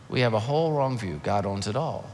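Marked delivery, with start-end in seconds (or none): 1.2-2.1 "God owns it all" much lower and softer; preacher softly repeating "God owns it all"; quiet restatement. Real, not acted. Repeated key phrase, quiet.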